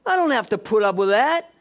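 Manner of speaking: loud, high, indignant; worked-up echo. Retold or imitated. Husband convinced by the enemy, loud and indignant. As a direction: shout it back in self-righteous anger.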